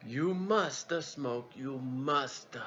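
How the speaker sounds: put-on slow, halting Italian-accented English with pauses; Italian accent, hesitant and emphatic; Italian captain accent. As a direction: insist warmly in broken Italian English. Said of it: "I did the voice thing".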